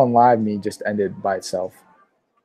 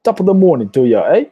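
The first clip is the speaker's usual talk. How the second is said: put-on a high, loud stab at a British accent; jokey attempt at a British accent, given up on right after; mock British accent; 6 semitones higher, 6 dB louder than usual. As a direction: ham up a British greeting, badly. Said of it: "I'd rather have a British accent so I could be like".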